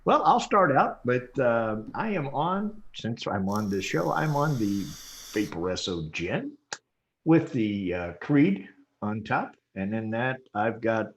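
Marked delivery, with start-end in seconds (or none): none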